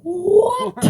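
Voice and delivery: very high, loud shriek of disbelief; hammed-up appalled yelp; shocked outburst. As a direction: squeal it in comic shock. Retold shock at cousin's confession.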